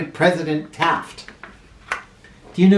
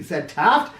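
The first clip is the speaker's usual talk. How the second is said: ordinary delivery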